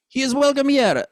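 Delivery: "here" as "ir", no H, rolled R, high; French accent. Shown as a demonstration. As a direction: drop the H in "here" and roll the R. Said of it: "a little bit of a roll of the R".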